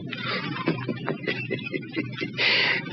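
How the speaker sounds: high, breathy mad-scientist cackle; maniacal cackle. Acted. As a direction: cackle in triumph like a madman. Mad doctor begins his experiment.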